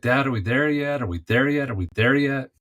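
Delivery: put-on high, repetitive child's whine; impatient eight-year-old asking again and again; whiny kid. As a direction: nag like a bored kid in the back seat. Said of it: "he's like".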